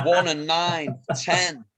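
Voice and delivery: high sing-song number call; bingo caller. Pretend. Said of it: "Bingo caller was mine".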